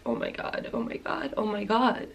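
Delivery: near-silent repeated gasps of dread; panicked inner whisper. Retold or imitated. Her inner voice at dinner, a hushed panicked whisper. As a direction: whisper the panic in your head. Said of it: "like, in my head".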